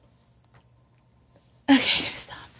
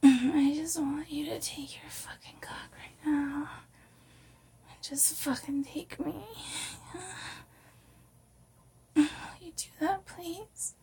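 soft seductive pleading; 4 semitones higher, 8 dB quieter than usual. Pretend. Performs the sultry audio in accent.

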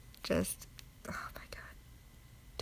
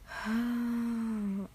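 long drawn-out sigh; 4 semitones higher than usual. Real, not acted. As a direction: let out a long, steady sigh. Alone before answering.

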